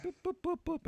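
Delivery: squeaky high boop noises; playful high-pitched boops; high silly boops. Pretend. Silly high sound effect.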